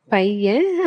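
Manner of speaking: usual speaking voice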